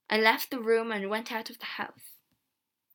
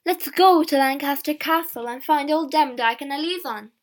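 loud, rallying call; rally everyone with a raised voice; crying out; 3 semitones higher, 8 dB louder than usual. A narrator's reading. Old woman urges visitors to the castle, crying out. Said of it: "an old woman cried".

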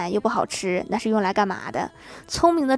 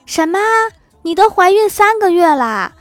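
much higher and louder, shocked; loud astonished cry; 8 semitones higher, 6 dB louder than usual. Retold or imitated. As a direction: blurt it out for the whole train. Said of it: "立刻大声说".

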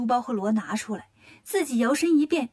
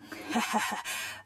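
low, soft villain's chuckle; pleased chuckle; 3 semitones lower, 10 dB quieter than usual. A narrator's reading. Demon king delighted by his messenger's lie, low pleased chuckle. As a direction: chuckle low to yourself, acted.

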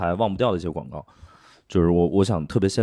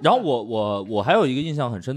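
usual speaking voice

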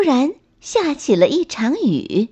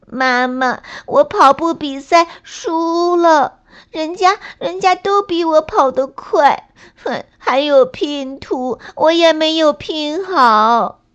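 put-on high whiny sobbing child voice; acted crying little rabbit, pitched high; tearful; 6 semitones higher than usual. A narrator's reading. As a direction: wail your failures to mum. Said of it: "小兔带着哭腔说道".

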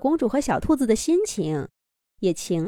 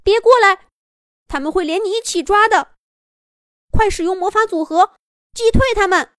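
high, loud, urgent warning yell; panicked, pitched-up urging; anxious shouting; 11 semitones higher, 6 dB louder than usual. A narrator's reading. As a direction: shout a desperate warning to a friend. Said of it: "小兔子着急地喊道".

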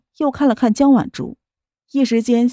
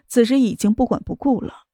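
ordinary delivery, nothing marked

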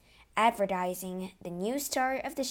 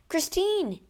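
high, loud call of her name; shout; 11 semitones higher, 6 dB louder than usual. A narrator's reading. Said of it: "shouted the Count".

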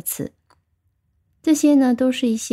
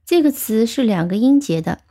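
ordinary talk, nothing marked

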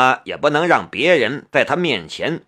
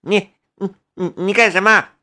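higher-pitched, halting stutter; frightened stammer; 6 semitones higher than usual. A narrator's reading. Driver grabbed by the collar, stammering in fright. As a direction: stammer out a startled challenge. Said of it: "吓得结巴了".

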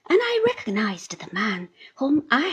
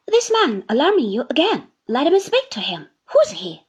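hurried; 3 semitones higher, 6 dB louder than usual. A narrator's reading. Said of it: "said I, hurriedly".

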